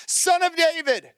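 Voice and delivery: high pleading call. A narrator's reading. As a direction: call out over the crowd. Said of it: "he shouted all the louder".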